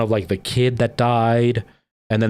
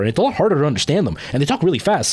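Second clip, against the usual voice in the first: ordinary delivery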